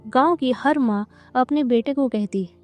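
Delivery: plain reading voice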